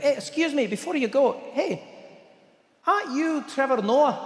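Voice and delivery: high-pitched Indian waiter, eagerly inquiring; Indian accent. Acted. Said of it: "you, you Indian?".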